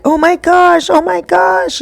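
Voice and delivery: high-pitched imitation of a panicked woman; retold panic. Girlfriend after her son almost drowned, retold panic.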